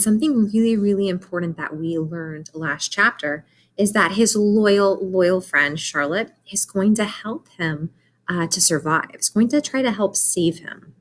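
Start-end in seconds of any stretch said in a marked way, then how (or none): none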